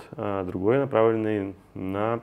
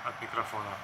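off-axis from the mic; off to the side; 10 dB quieter, faster than usual. Real, not acted. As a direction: speak beside the mic. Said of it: "я говорю сбоку".

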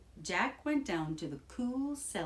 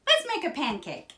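high, loud eager cry; excited shout; 10 semitones higher, 8 dB louder than usual. A narrator's reading. Jack urges his mother to start cooking, shouting. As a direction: call it out like an eager boy. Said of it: "shouted Jack".

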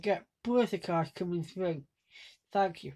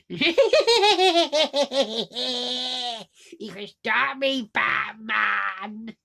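long cackle, then slow villain taunt; cackle, then draw out the taunt; Joker; 3 semitones higher, 4 dB louder, much slower than usual. Acted. Villain taunting the hero, weird laugh and slow words. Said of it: "Joker goes".

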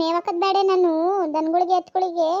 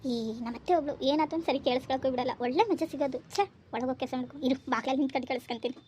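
hushed, much softer gloating aside; sneaky aside; 3 semitones lower, 7 dB quieter than usual. Acted. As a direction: gloat under your breath so nobody hears. Muttering aside while eavesdropping. (ಮನೆಯವರಿಗೆ ಕೇಳದಂತೆ ಸೊಸೆಯ ಮೆಲ್ಲನೆ ಖುಷಿಯ ಮಾತು)

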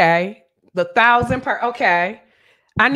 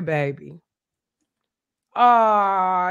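usual speaking voice

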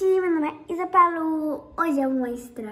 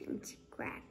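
whisper; 17 dB quieter, faster than usual. Real, not acted. Daughter answering a quiz question.

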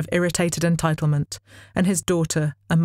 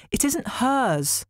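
slow, high, sulky protest; petulant goddess; 8 semitones higher, much slower than usual. A narrator's reading. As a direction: drag it out like a sulky child. Athene's petulant character line.

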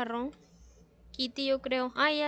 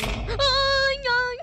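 high frightened yelp; startled cry; 11 semitones higher, 8 dB louder than usual. Real, not acted. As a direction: yelp at a sudden noise. Sudden noise in game.

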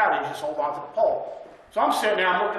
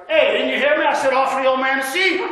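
raised, louder and higher; a pushy louder voice repeating the order; insistent call; 6 semitones higher, 6 dB louder than usual. Retold or imitated. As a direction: call out sharply, demanding. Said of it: "Like that".

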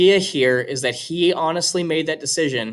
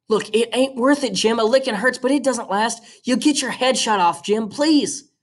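put-on higher girl pleading with her brother; higher young girl's desperate plea; girl's voice, begging; 9 semitones higher than usual. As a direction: beg him not to go, higher. Scout desperate with Jim.

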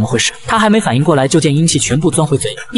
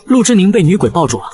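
usual speaking voice

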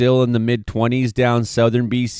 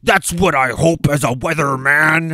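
high, put-on sarcastic weatherman voice; sneering doom-monger weatherman act; mock alarmist weatherman; 7 semitones higher than usual. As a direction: parody a fearmongering forecaster. Mocking alarmist weatherman persona.

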